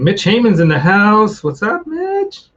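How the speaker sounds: high, drawn-out hello to a viewer; excited greeting. Real, not acted. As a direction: call out his name, delighted.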